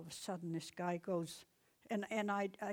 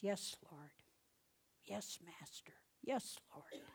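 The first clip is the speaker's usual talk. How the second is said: hushed whisper; 7 dB quieter than usual. Acted. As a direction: whisper it, humble and awed. Blind men answer Jesus humbly.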